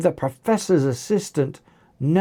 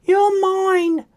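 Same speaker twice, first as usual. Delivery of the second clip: squeaky-high, held-out threat; high-pitched voice; 4 dB louder than usual. A narrator's reading. Beefy clone claims his opponent after roaring, high-pitched voice. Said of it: "he said in a high-pitched voice".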